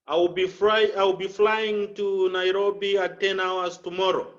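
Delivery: no rise and fall of the voice; monotone. Shown as a demonstration. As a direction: say it all on one level note. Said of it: "That is the monotone we are talking about".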